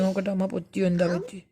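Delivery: barely above a breath, gentle; hushed and tender. Real, not acted. Mother checking baby's hurt.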